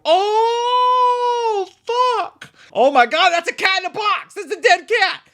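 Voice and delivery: high, loud crying-out in horror; shocked outcry. Retold or imitated. Watching the dead cat reveal in the theater, shocked high cry. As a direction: react like you just saw something awful. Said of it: "and I go".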